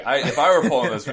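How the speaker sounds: ordinary talk, nothing marked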